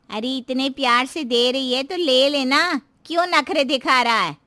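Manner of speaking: loud, pushy telling-off; chiding neighbour. Acted.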